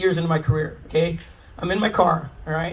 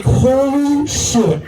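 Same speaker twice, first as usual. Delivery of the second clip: higher, very drawn-out swearing; stunned disbelief; 4 semitones higher, 3 dB louder, much slower than usual. Retold or imitated. Officer reacting to a screaming mother, stunned drawn-out exclamation. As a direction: stretch the first word out in shock.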